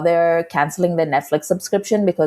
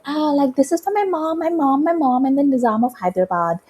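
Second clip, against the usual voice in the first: high-pitched gushing mimicry; mock socialite voice; 8 semitones higher, faster than usual. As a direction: gush it out high and breathless. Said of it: "was just like".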